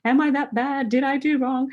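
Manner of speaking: mock distress, voice lifted; retold tearful dismay. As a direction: imitate a hurt girl doubting herself. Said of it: "she's crying and she's like".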